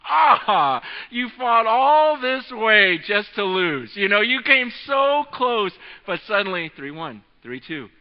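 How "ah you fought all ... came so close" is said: slow, louder, sympathetic sigh; imagined pity for the losing player, slow and louder; pitying sympathy. Retold or imitated. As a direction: console the tired old champion. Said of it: "And you're like".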